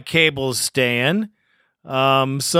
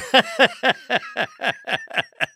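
real high-pitched giggle; high laugh; 7 semitones higher than usual. Real, not acted. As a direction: laugh at your own joke. Host laughing at own joke.

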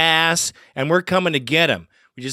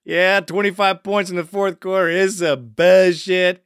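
ordinary delivery

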